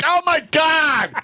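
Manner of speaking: mock horror. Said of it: "I'm like".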